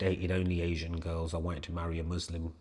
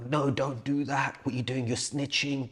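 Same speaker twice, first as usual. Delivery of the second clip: urgent warning; 6 semitones higher than usual. Retold or imitated. Voicing Scouser neighbour shouting through door.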